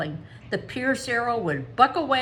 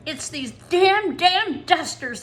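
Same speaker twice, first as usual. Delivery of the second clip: near tears; 8 semitones higher, 6 dB louder, slower than usual. A narrator's reading. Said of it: "Anne almost wept".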